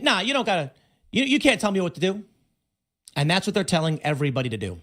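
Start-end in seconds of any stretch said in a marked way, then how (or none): 0.0-2.2 mocking a defiant brush-off, a bit higher; mock defiance. Mocking people told to defy authority, scornful defiance. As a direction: sneer it like a rebellious kid. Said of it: "we should be saying".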